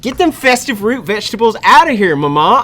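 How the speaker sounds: put-on high, loud bratty kid yelling at mom; whiny rich gamer kid. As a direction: throw a spoiled tantrum at Mama. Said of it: "New character, Rich Gamer Team".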